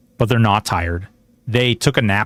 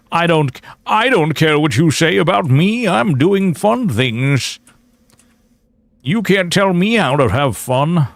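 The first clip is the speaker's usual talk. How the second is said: mock defiance; 6 semitones higher than usual. Defiant mock rant at critics.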